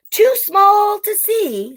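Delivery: high, slow small; hushed-high storybook wonder. Acted. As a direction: draw out the smallness. Storytime emphasis.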